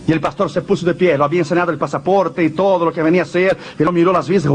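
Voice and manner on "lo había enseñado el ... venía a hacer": ordinary talk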